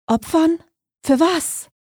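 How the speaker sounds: high, startled questions; anxious, high-voiced and halting; alarmed questioning. A narrator's reading. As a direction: jump up in pitch, asking in fearful disbelief. Frightened girl questions the stranger.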